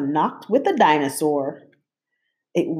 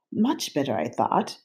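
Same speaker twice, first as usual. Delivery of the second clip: ordinary delivery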